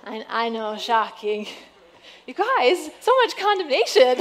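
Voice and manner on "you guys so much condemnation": higher, louder mock hurt then laugh; high mock outrage into laughter. Real, not acted. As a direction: play wounded, then laugh. Mock offended at congregation.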